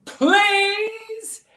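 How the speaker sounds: very high, drawn-out pleading wail; hammed-up desperate begging; meltdown. A narrator's reading. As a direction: wail like a child in a full tantrum. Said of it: "here's where the meltdown begins".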